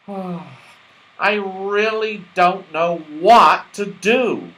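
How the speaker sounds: slow, puzzled and weary; frowning. Acted. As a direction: drag it out, at a loss.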